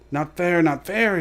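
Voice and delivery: Gollum's hissed complaint. A narrator's reading. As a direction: hiss it out, offended. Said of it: "he hissed".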